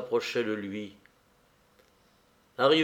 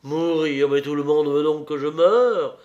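despairing cry; 5 semitones higher, 7 dB louder than usual. A narrator's reading. Nero despairs, abandoned by all.

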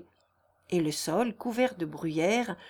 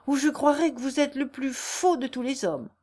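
indignant rebuff; 6 semitones higher, 4 dB louder than usual. A narrator's reading. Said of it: "dit Jeanne en le repoussant avec force".

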